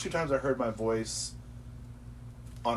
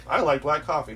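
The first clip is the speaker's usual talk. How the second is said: mock proud claim; 5 semitones higher, 6 dB louder than usual. Mock claim of liking coffee.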